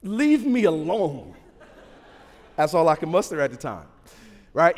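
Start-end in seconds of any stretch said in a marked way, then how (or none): 0.0-1.3 put-on slow, louder, sulky five-year-old; a petulant kid's leave me alone; childish retort. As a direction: pout it out like a child. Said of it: "with the maturity of a five-year-old".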